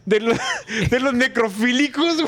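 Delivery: laughing. Real, not acted. Joking about rescuing the dead.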